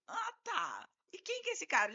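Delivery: high-pitched mock credulity; sceptical questioning. Lawyer humouring client's complot story, high-pitched scepticism. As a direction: humour a far-fetched story, voice well up.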